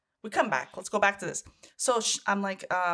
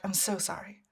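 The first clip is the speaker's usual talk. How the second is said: fading apologies; 7 dB quieter than usual. Retold or imitated. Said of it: "she'd be like".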